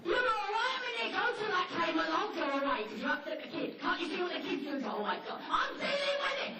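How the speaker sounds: high loud near-crying pleading; frantic dog. Pretend. Dog drives off the threat, high loud near-crying.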